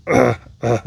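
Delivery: two short mock retches; imitated retching. Imitating friend being sick into her kitchen sink, mock retching. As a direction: mimic someone throwing up. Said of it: "she just threw up".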